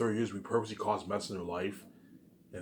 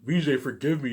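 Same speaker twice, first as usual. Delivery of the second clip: mock tearful plea; 5 semitones higher, 7 dB louder than usual. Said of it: "Her stepsister's gonna cry".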